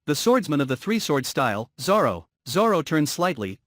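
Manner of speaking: ordinary delivery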